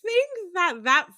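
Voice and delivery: breaking into laughter, slowing down; laughing. Real, not acted. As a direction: laugh it off at your own expense. Laughing about finishing PhD late.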